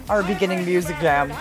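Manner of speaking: ordinary delivery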